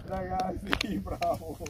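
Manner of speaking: ordinary talk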